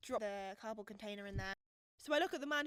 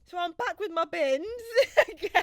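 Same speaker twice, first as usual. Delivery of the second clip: high, loud, breaking into laughter; helpless laughter; 6 semitones higher, 13 dB louder than usual. Real, not acted. Getting back to the bins story, real helpless laughter. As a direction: push the words out through real laughter.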